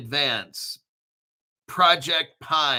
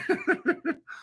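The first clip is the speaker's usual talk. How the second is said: soft laugh; 12 semitones higher, 4 dB quieter than usual. Real, not acted. Still laughing.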